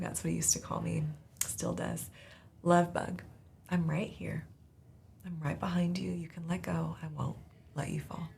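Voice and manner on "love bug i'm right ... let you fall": soft, fading to a whisper, a reassuring father; gentle dad. Retold or imitated. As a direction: whisper it like a dad calming his scared child. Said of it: "in his strong and gentle voice".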